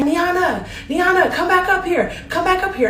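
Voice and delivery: mock yell up the stairs; calling out her name. Guy calling her back upstairs in a high yell. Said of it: "He starts screaming my name".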